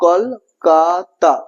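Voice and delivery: কলকাতা slowly divided into kol-ka-ta; syllable by syllable. Shown as a demonstration. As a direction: separate the three syllables of কলকাতা. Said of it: "আমরা কলকাতা তিনটে পেয়েছি".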